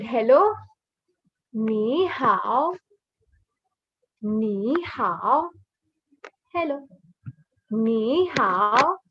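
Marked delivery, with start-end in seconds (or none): 1.5-5.5 "Ni hao" drawn out with clear tones; model pronunciation. Shown as a demonstration. Teacher models hello for learners, drawn-out toned "Ni hao". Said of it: "So let's read". 7.7-9.0 "Ni hao" stretched, with a deep dip on hao; careful tones. Shown as a demonstration. Teacher models hello, drawn-out toned "Ni hao". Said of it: "So the tones are important".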